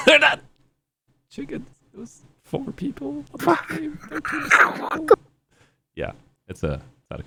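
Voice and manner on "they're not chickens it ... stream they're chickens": high, slow, laughing put-on voice; high mock voice. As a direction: squeak it out in silly disbelief. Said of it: "I'd be like".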